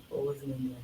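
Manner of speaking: quiet echo. Real, not acted. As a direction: let it fall away to near nothing. Repeats phrase, echo fading.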